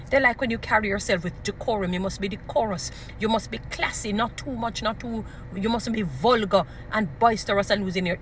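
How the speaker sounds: ordinary delivery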